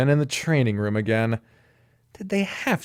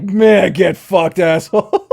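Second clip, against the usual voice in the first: rude snake voice; 6 semitones higher, 8 dB louder, slower than usual. Retold or imitated. Voicing the snake's face.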